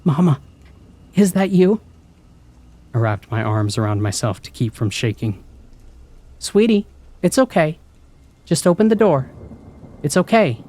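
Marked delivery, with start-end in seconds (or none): 0.0-1.8 put-on scared child. Frightened child calling mother. 6.4-10.6 high, soothing mother voice; coaxing mother. Acted. Mother coaxing through door.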